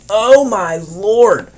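long drawn-out, high, loud exclamation; slow wail of dismay; appalled cry. Real, not acted. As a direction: stretch it out in horror. Horrified by the picture.

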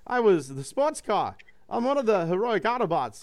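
lightly voiced robot puffing up his chest; proud Hot Rod. Hot Rod boasting to the hero about being Autobots, proudly. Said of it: "says Hot Rod proudly".

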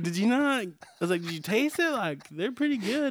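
incredulous questioning. Retold or imitated.

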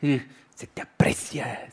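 a slow creepy whisper; whispered in the ear. Retold or imitated. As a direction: breathe it into someone's ear. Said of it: "Pero hablándote al oído". (susurro al oído)

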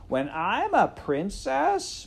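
put-on high, loud, slow girl's voice; boastful serving girl. A narrator's reading. Serving girl dreaming aloud of being princess, boastful and loud. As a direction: crow it with no care for secrecy.

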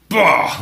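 sudden shouted bah; loud burst. Retold or imitated. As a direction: blast it out like a guitar hit. Imitating the song's hard hit.

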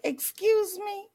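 a mock affronted excuse me; offended. Offended giver reacts.

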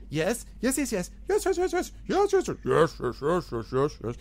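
a fast, high rising run of "yes"; goblin babbling excitedly. Acted. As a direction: babble "yes" and build, then settle. Goblin pointing at himself, babbling.

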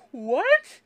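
high, loud disbelieving What; shocked what. Real, not acted. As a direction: blurt it out in sudden disbelief. Shocked on reading the news.

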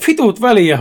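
acted shout; shouted retort. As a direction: throw it back with a mild yell. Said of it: "huusi Joni".

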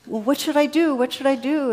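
loud, high panicked pleading; frantic shout. Retold or imitated. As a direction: shout it up in a frenzy. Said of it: "and he goes".

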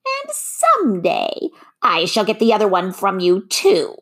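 high, menacing witch voice; threatening witch. A narrator's reading. The witch vows to take the other shoe, high and menacing.

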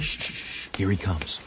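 hushing whisper. Acted. Father hushes his wife as the boy returns, whispering. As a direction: hiss a quick shh before the boy hears. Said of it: "Why are you whispering?".

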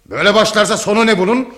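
high, heated retort; angry. Acted. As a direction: fire back in angry alarm. Son angrily rejects mother's excuses. (öfkeli, yüksek perdeden çıkışma)

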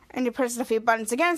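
normal talking voice

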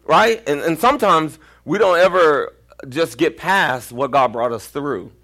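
ordinary talk, nothing marked

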